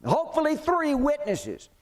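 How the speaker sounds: a priest eager to have his witnesses lined up; high priest. Acted. Preacher voicing the high priest at the trial, high and insistent. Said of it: "But the high priest says".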